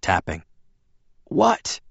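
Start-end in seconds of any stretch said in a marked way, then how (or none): 1.2-1.8 weak Bella voice. A narrator's reading. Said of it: "Bella asked".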